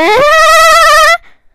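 high loud fake laugh. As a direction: force a shrill fake cackle. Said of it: "risa más trucha".

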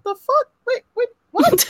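very high, laughing disbelief; acted-out incredulous double take; baffled reaction. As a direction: squeak it out in mock bewilderment. Acting out her baffled reaction.

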